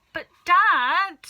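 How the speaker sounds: high, loud interruption; boy shouting to be heard; loud plea. Acted. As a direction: cut in on the father.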